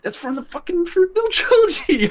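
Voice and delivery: very high, laughing, thrilled insistence; excited laughing insist. Retold or imitated. Fan repeating himself to his dad, squealing and laughing. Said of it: "and they're like, "What?".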